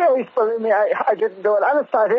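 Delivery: high, panicky denial; scared high pleading; frightened pleading. Acted. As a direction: beg him to believe you. Accused man pleading innocence.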